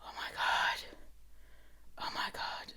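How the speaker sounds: whispered oh my God, scandalised; hushed appalled whisper; mock horrified whisper. Real, not acted. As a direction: breathe it out in shock.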